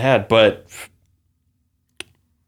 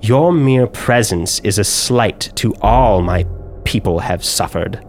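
menacing drow noble. Acted. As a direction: lay out each accusation like a sentence passed. GM voices drow woman.

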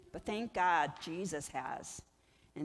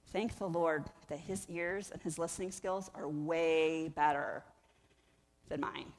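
ordinary talk

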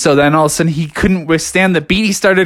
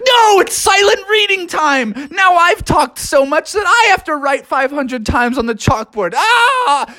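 exasperated shout; 12 semitones higher than usual. Retold or imitated. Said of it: "And he's like".

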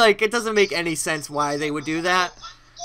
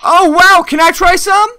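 high, loud, hammy ad delight; overjoyed customer in an old commercial; chipper 1950s ad; 9 semitones higher, 10 dB louder than usual. Acted. Fake ad customer relieved.

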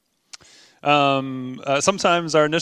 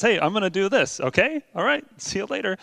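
higher-pitched, breezy and offhand; santai dan enteng; 4 semitones higher than usual. Acted. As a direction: toss it off like a casual promise. God voiced as breezily casual.

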